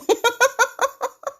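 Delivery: long high giggle; can't stop laughing. Real, not acted. Host laughing during live tutorial, high giggle. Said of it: "je vais passer mon temps à rigoler".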